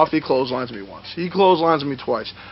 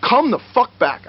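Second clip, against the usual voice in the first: higher, emphatic angry demand; retold pissed-off order; 6 semitones higher than usual. Wrestler ordering opponent back, retold pissed-off demand. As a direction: order him back in mock anger. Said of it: "I'm pissed".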